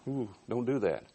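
softly warning someone off; hushed, cautious "don't do that"; nervous hushing. Acted. Fearful hushing about another king.

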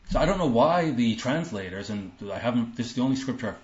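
normal talking voice

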